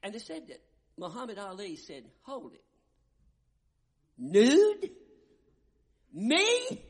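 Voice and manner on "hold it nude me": high, loud outraged squawk of disbelief; indignant Ali. Retold or imitated. Preacher mimicking Ali, recoiling at a nude scene, shrill and outraged.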